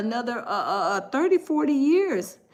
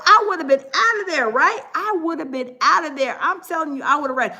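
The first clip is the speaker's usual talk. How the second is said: usual speaking voice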